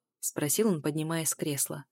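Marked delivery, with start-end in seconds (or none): none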